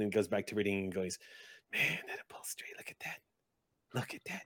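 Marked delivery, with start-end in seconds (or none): 1.7-4.4 hushed, whispered admiration; goblin whispering. Acted. Goblin ogles pictures in a magazine, whispering to himself. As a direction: whisper to yourself over a magazine.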